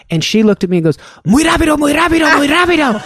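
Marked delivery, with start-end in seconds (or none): 1.2-3.0 high, rapid-fire mocking repetition in Spanish; furious nurse. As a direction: imitate an angry nurse throwing the words back. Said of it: "fury in her eyes".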